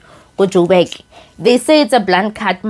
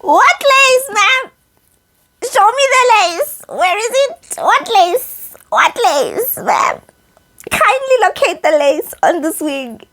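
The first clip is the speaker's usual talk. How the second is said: much higher, cheeky interrogating voice; sassy mock demand; 11 semitones higher, slower than usual. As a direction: playfully demand to be shown the lace. Teasing about the invisible lace.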